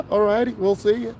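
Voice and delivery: high, chipper goodbye; bright fake-nice farewell; code-switching voice.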